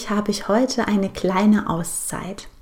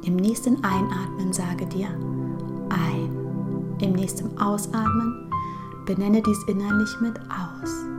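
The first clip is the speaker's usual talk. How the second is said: guided meditation; 6 dB quieter than usual. Acted. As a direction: guide her gently into calm, breath by breath.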